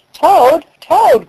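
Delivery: high, loud repeated call. A narrator's reading. Frog crying out to sleeping Toad. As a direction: cry out to rouse him. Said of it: "cried Frog".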